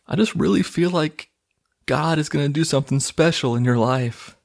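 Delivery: high-pitched mock wife voice; imitated movie wife. Wife to husband in conversation, imitated high voice. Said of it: "and she's like".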